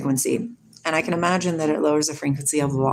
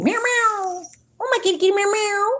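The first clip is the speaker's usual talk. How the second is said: high playful meows; cat meow; 13 semitones higher than usual. Pretend. Calling her cat with high playful meows.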